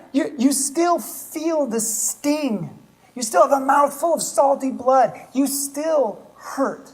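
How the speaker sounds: earnest sermon appeal. Acted. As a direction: press the pain home to the congregation. Preacher's intense empathetic passage.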